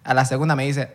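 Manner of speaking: ordinary delivery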